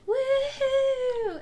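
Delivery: high, loud whooping cry; mock woohoo scream. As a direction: whoop like a fan at the door. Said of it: "people just screaming".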